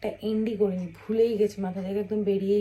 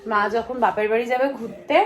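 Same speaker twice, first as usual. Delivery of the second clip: normal talking voice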